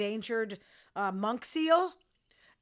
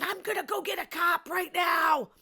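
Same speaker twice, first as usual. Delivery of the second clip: higher and louder retold threat at the dog abuser; retold scream; 9 semitones higher, 7 dB louder than usual. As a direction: yell the threat at the man. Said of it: "I started screaming".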